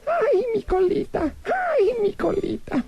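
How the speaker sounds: mock sobbing granny complaining about her tail; whining old lady. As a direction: moan over a sore tail like a hurt granny. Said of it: "Nada más decía".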